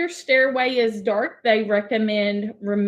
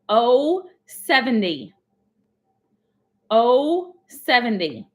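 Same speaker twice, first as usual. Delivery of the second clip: slow, drawn-out number call; bingo caller; 4 dB louder, slower than usual. Acted. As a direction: call each number out slowly to the room.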